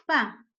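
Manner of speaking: "pa" punched out loud on its own; stressed syllable. Shown as a demonstration. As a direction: hit "pa" hard as the strong syllable. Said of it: "su sílaba tónica de la palabra espacio". ("pa" fuerte y aislada, como sílaba tónica)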